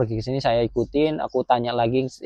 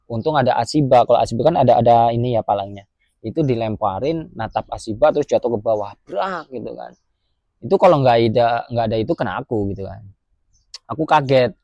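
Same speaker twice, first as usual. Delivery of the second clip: usual speaking voice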